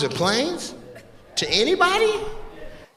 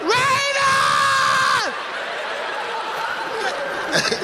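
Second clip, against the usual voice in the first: crowd laughter. Real, not acted. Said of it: "audience laughs".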